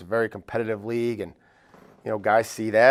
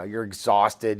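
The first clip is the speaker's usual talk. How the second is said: ordinary delivery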